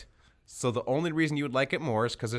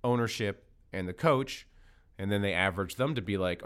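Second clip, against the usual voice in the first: normal talking voice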